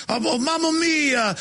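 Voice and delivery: high, slow mock Italian accent; exaggerated, high-pitched Italian caricature; fake Italian accent. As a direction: exclaim in a hammed-up Italian accent. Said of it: "some kind of fake Italian accent".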